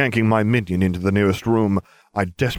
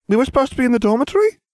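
put-on a much higher, louder, very fast boy; Ron's question; 5 dB louder, much faster than usual. A narrator's reading. Ron surprised by the teacher, high and quick. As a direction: blurt it out in surprise. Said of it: "the Weasley asked".

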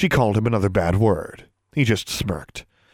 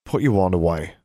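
normal voice, unmistakably an order; quiet command; 3 semitones lower than usual. A narrator's reading. Boy ordering girl to stow her wand, quietly commanding. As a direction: give a firm order without raising the voice. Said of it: "said it in a normal tone of voice, it was unmistakably a command".

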